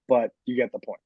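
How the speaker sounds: usual speaking voice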